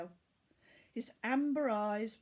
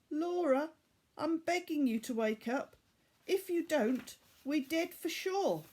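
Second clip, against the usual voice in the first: put-on pleading boy; 7 semitones higher, 3 dB louder than usual. Tariq pleading her to wake.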